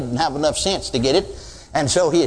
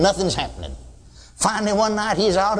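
ordinary delivery

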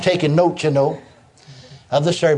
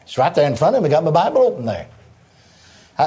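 ordinary talk

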